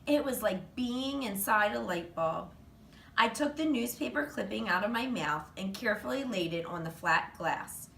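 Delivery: ordinary delivery, nothing marked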